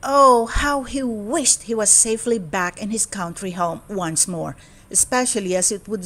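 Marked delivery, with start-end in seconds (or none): none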